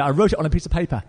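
ordinary talk, nothing marked

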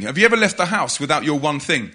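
usual speaking voice

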